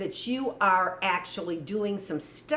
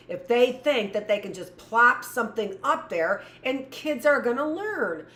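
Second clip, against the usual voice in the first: usual speaking voice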